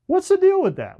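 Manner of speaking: high-pitched exasperated what's the deal; exasperated outburst. Real, not acted. Lecturer to his class about airport strangers, exasperated high-pitched outburst. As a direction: squeak out the disbelief, pitch jumping way up.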